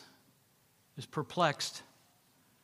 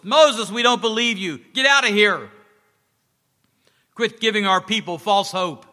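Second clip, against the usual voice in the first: loud, higher angry jeering at Moses; shouting crowd; 5 semitones higher, 8 dB louder than usual. Acted. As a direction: chase him off with your voice. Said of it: "started shouting at Moses".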